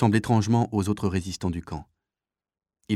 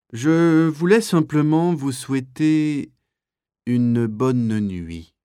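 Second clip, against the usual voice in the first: very slow, stretched words, falling at the end; drawn-out address; 5 semitones higher, 5 dB louder, much slower than usual. Acted. SS officer wishing prisoners good night, slow drawling speech. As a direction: start high and loud, then drag it down.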